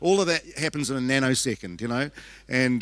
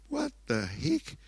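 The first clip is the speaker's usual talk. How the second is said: high-pitched, softer, drawn-out mock astonishment; stunned disbelief; 13 semitones higher, 6 dB quieter, slower than usual. Mother hearing her son's demon story, retold bewilderment. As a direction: stretch it out high, dumbfounded.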